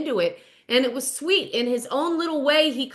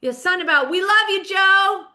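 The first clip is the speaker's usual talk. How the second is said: high, loud mock audience cheer; heckler's shout; 7 semitones higher, 6 dB louder than usual. Audience member yells to Joe mid-show, loud cheering shout. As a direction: call out to the stage like a fan. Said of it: "you hear somebody go".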